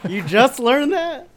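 very high, loud, slow disbelief; high loud incredulous. Real, not acted. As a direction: squawk in mock shock. Friend incredulous.

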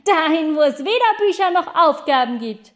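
high, loud call; boy calling down to the schoolchildren, loud and high. Acted. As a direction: shout it down to the children. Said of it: "rief der Junge".

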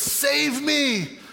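high-pitched cry for help; mild acted desperate plea; acted plea for rescue. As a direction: call out as if sinking. Peter crying out while sinking.

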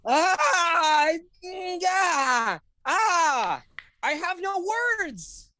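high, squealing overwhelmed voice, drawn out slowly; overwhelmed gush. Real, not acted. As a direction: gush like it's too much to bear. Overwhelmed by the sad movie.

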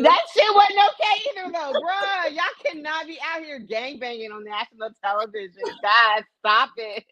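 high, loud mock reprimand; mock scolding. Mock scolding the guys on camera.

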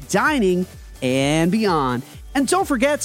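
ordinary talk, nothing marked

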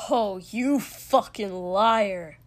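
slow, loud, venomous accusation; furious, drawn-out contempt; spat out. A narrator's reading. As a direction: spit each word out in anger. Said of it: "Katsuki spat".

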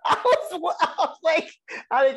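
high, loud, laughing through the words. Real, not acted. Co-host cracking up over a weird scene, laughing hard. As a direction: struggle to talk through real laughter.

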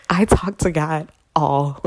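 ordinary delivery

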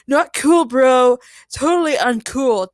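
loud, high dude voice; bro disapproval. A narrator's reading. Chad chimes in against the villain, bro disapproval. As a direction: scold him like an offended bro. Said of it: "Chad chimed in".

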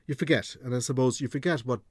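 ordinary talk, nothing marked

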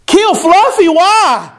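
high, mock-startled child's cry; a schoolgirl appalled at the idea; shocked little girl. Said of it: "a little girl named Lucy".

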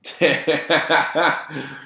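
hearty burst of laughter; real, drawn-out laugh. Real, not acted. Reacting to the joke.

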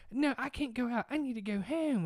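high mock panicky girl voice. Retelling the girl freaking out, high whine. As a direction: whine it out like a scared teen. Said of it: "she was freaking out".

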